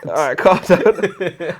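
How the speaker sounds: slow, laughing mock calm down. Real, not acted. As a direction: tease him through a laugh. Teasing friend eager to rip quote.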